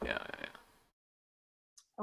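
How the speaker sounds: slow breathless whisper. Acted. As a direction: whisper it, drawn out. In-character murmured agreement.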